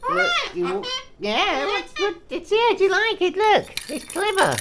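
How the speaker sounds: squeaky sing-song praise for the bird. Real, not acted. Showing bird its bubble.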